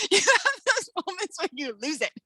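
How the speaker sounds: laughing through "have those moments", pitch still up. Real, not acted. Author laughing with the host about losing it. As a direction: repeat it with a laugh bubbling in.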